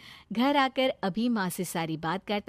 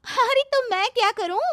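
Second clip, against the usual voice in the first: much higher, louder protest; exasperated retort; 7 dB louder than usual. Acted. Girl refuses to help the suitor, exasperated. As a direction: throw it back at him, pitched up and louder.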